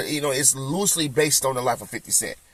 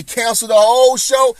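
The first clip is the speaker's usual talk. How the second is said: loud protest; 6 semitones higher, 6 dB louder than usual. Real, not acted. Insists show dies without Marvin.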